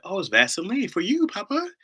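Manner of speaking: put-on high, sing-out small-child answer; little daughter. Daughter explains the mess to her dad, innocent little-girl voice. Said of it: "And she's like".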